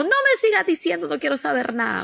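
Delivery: high-pitched mock protest; imitated protesting husband. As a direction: act out a husband refusing to listen. Voices husband refusing to hear more.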